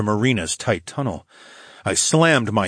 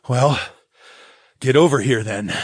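growled; 4 semitones higher, 4 dB louder than usual. Acted. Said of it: "I growled".

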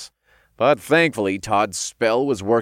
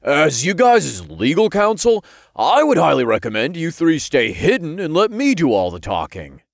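loud, higher imp giving legal advice; self-important imp counsel; 8 semitones higher, 6 dB louder than usual. A narrator's reading. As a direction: pitch it up and advise emphatically. Imp plays lawyer in story.

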